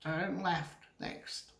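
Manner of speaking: slow, soft robotic GPS prompt. Shown as a demonstration. Teacher mimicking phone GPS for students, robotic voice. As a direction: imitate a phone's navigation voice, slowly. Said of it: "you use your G".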